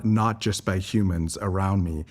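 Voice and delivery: ordinary talk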